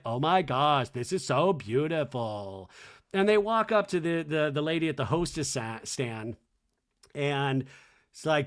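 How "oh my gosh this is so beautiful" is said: low, slow, drawn-out gush; Lisa. Retold or imitated. Lisa having the time of her life, low slow mimicked awe. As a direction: savor each word in mock bliss. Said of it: "having the time of her life".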